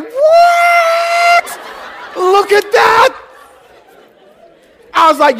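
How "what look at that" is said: high, loud astonished cry; amazed shout. Retold or imitated.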